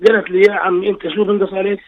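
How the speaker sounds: ordinary talk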